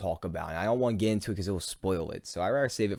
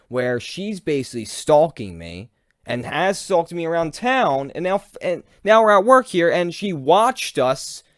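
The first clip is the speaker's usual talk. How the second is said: louder and higher, heated; building fury, pushing the words out; real heated rant; 6 semitones higher, 8 dB louder than usual. Real, not acted. Ranting about being stalked.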